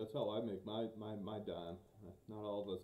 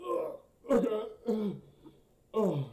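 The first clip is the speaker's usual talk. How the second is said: high strained groan of pain; 13 semitones higher, 10 dB louder, much slower than usual. Acted. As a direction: groan as if hurting to stand. Injured character getting up.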